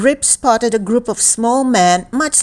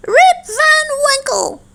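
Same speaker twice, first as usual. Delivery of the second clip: very high, eerie far-off cry; strange calling voice. A narrator's reading. Mysterious stranger calls to Rip on the mountain, high strange call. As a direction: call out the name in a high, unearthly voice. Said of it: "a strange voice called Rip's name".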